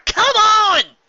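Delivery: high, loud yell that cracks on on; frustrated shout, voice cracking. Acted. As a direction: yell until the voice breaks. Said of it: "Her voice cracked on the second word".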